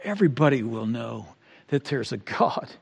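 soft, fading declaration; hushed. Acted. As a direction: sink to a hush. Quiet closing declaration.

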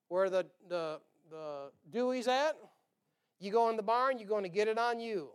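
normal talking voice

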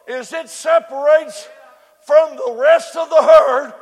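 loud, rising preacher cadence, hitting key words; preaching at full force. Acted. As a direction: whoop it up to the congregation. Preacher building the zebra illustration.

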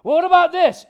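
the devil pressing Jesus again; pushy devil. Retold or imitated. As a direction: jab back with a new trick. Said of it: "devil voiced in retold scene".

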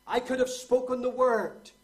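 high, ringing pulpit shout; loud preaching cry. Real, not acted. Preacher voices Christ's claim of power, loud preaching cry. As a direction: burst out at full volume.

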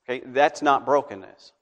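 ordinary delivery

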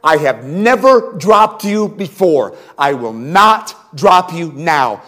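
loud, slow, insistent plea; slow earnest pleading. Retold or imitated. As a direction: lean on every word to win his trust. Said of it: "I am pleading with him".

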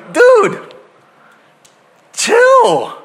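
a friend in the passenger seat reining in the driver; telling a driver to chill. Retold or imitated. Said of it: "riding with this guy going".

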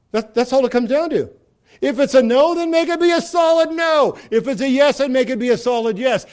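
forceful preaching. Real, not acted. Preacher exhorting the congregation to keep their word. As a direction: drive home the yes and no. Preaching emphasis, shout on solid no.

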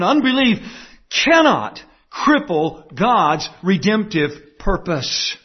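slow, "cannot" driven home; emphatic slow. Real, not acted. Preacher restating key point.

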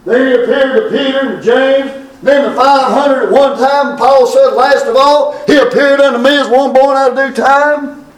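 high, ringing proclamation; preaching. Acted. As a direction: lift it up to the crowd.

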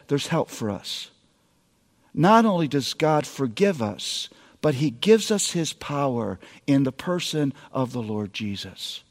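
a dad quietly telling his daughter God helps; tender father. Retold or imitated. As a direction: bring it down to a hush. Dad gently tells daughter of help.

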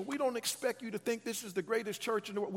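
ordinary delivery

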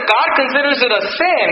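ordinary delivery

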